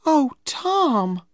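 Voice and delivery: high-pitched, wounded exclamation; girlish dismay. A narrator's reading. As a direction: sound hurt, voice pitched high. Becky realises Tom's earlier engagement.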